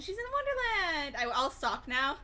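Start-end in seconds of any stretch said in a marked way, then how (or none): 0.0-1.2 excited. Real, not acted. Climax of the retold plot.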